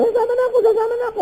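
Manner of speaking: very high-pitched mock graveside wail; high, hammed-up grieving cry; mourner wanting to follow. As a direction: imitate a mourner begging to go along. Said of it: "At yung sumasama".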